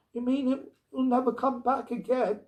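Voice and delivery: put-on high, shocked child's question; a girl fearing her friend is lost; horrified child. A narrator's reading. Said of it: "eyes were round with horror".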